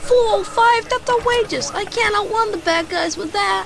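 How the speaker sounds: high, loud indignant outburst on the phone; outraged. Acted. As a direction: scoff at the offer like it's an insult. Haggling over car engine on phone.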